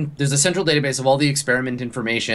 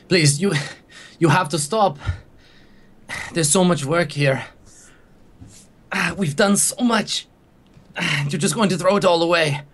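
higher, begging, voice catching; desperate pleading; 5 semitones higher than usual. Acted. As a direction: plead with them not to destroy it. Scientist begs them stop.

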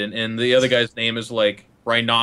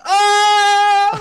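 loud, high mock scream of frustration; wordless hammed-up yell; exasperated cry; 7 dB louder than usual. Player giving up on child teammate, exasperated scream. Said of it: "And I'm like".

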